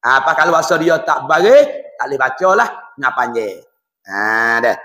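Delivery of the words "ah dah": usual speaking voice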